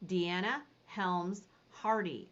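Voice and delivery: normal talking voice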